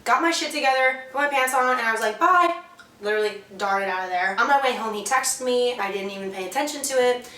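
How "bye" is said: high, loud clipped farewell; leaving the date for good, loud goodbye; curt loud bye. Retold or imitated. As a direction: fling it over your shoulder. Storming out.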